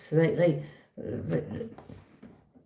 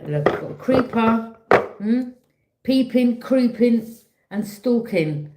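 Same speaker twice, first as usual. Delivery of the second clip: playful creeper alert; 5 semitones higher, 5 dB louder, slower than usual. Real, not acted. Excited reading about creeper.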